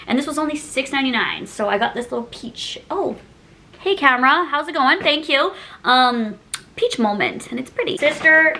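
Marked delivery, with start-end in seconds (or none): none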